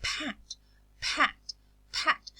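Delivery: "pat" three times, separated, a puff of air after each p; aspirated p. Shown as a demonstration. Teacher showing the paper test, each "pat" said with a breathy p. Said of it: "because of the aspiration".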